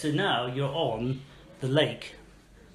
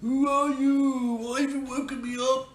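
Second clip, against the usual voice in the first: a grumpy tree spirit just woken up; sleepy tree voice; 12 semitones higher, 4 dB louder than usual. Acted. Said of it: "He moves his branches".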